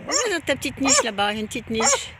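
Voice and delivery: high, loud pet-talk voice; high-pitched calling out to a guard dog. Real, not acted. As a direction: squeak up at the dog to win it over. Points dog to its kennel.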